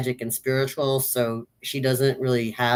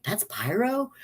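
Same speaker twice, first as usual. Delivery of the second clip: "That's Pyro?" rising in disbelief, higher; puzzled double take; doubtful question; 6 semitones higher, 6 dB quieter than usual. Retold or imitated. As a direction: ask "That's Pyro?" as if unsure it's him. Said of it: "at first I'm like".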